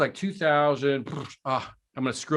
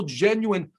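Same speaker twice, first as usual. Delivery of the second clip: ordinary delivery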